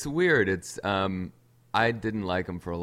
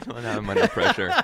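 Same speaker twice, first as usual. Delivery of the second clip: laughing; 6 semitones higher, 8 dB louder than usual. Real, not acted. Laughs while teased to swear.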